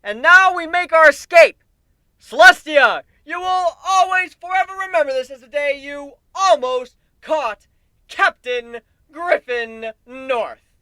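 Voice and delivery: high, loud taunting yell, slowing at the name; yelling over crowd. Acted. Captain taunting Celestia during the escape, loud and high. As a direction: shout it over the roaring crowd. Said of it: "I yell over the roaring crowd".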